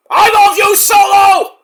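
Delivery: high, loud adoring fan yell; fan shout. Pretend. A fan in the arena screaming love at Solo, loud.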